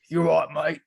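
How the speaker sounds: hammed-up English accent. Shown as a demonstration. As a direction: greet someone in a put-on English accent. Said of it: "this is my English accent".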